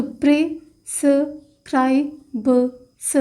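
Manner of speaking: Prescribes stretched very slowly while writing; drawn-out word. Shown as a demonstration. Teacher writing shorthand stroke on board, very slow. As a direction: spell out prescribes slowly, syllable by syllable. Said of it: "तो stroke create होगा".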